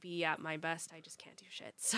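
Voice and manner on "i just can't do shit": whisper. Real, not acted. Muttered aside, unvoiced.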